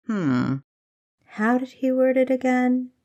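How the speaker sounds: put-on old mentor teasing his eager young spy; mock thoughtful. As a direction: pretend to search your memory, playing with her. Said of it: "Thomas began mock thoughtfully".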